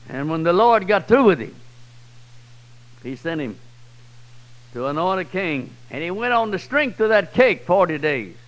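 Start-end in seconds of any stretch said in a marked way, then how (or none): none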